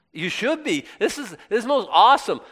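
high, emphatic enthusiasm; excited exhortation. Real, not acted. Preacher urges the congregation to be amazed, excited and high.

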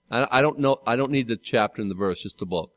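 ordinary talk, nothing marked

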